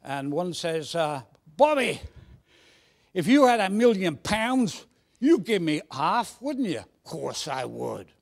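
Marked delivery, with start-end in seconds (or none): 1.6-6.8 put-on higher, louder Cockney boy; eager pitch in Cockney to a pal; Cockney accent. Cockney boy asking his pal to share, high-pitched Cockney. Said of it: "Should I put on a Cockney accent". 7.0-8.1 put-on low, slow Cockney boy; Cockney accent. Second Cockney boy promising his pal, low slow Cockney. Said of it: "Should I put on a Cockney accent".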